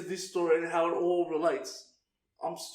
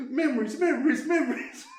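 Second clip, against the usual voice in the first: laughing mock lament; 8 semitones higher than usual. Mock trauma flashback.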